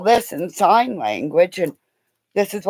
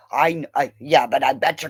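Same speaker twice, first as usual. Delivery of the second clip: ordinary talk, nothing marked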